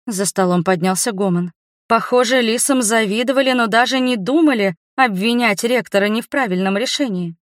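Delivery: ordinary delivery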